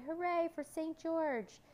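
high, drawn-out hooray; cheering. A narrator's reading. Crowd cheers for Saint George, high drawn-out cheer. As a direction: cheer slowly and high for a hero. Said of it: "Everyone in the clearing shouted and cheered".

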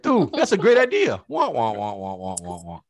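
hammed-up guy nodding along; zoned-out husband. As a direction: pretend to listen while tuning out.

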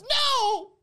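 put-on whining child. A spoiled kid begs mom and dad for Starbucks, loud high whining wail.

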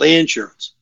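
insurance stressed as IN-surance; Texan-style IN-surance; first-syllable stress. Shown as a demonstration. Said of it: "emphasize the first syllable of every word".

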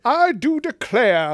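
slow, loud, high Southern-belle drawl; hammed-up Georgian drawl with British in it; mock British-Georgian accent. Co-host mocking an actor's muddled accent, British-Georgian. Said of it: "British slash Georgian accent".

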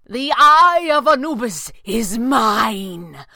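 loud, high, drawn-out possessive cry; shout. A narrator's reading. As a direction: shout it slowly, claiming the prize. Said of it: "Pavli shouted".

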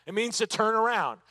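ordinary talk

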